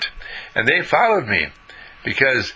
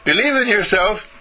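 raised encouraging little voice; little inner voice; 7 semitones higher than usual. Acted. The voice within telling Paul he can do it, lightly raised. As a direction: cheer yourself on from inside.

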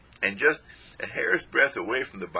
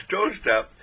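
dog's begging voice; 9 semitones higher, 4 dB louder than usual. Retold or imitated. Dog still begging.

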